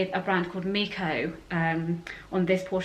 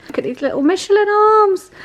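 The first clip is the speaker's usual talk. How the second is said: much higher and louder delighted coo; 11 semitones higher, 9 dB louder than usual. Real, not acted. Cooing over baby's chubby arms.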